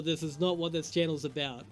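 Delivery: normal talking voice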